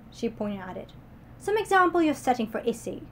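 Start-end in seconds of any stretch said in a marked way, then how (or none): 1.4-3.0 scolding. Acted. Miss Abby scolds Beatrice.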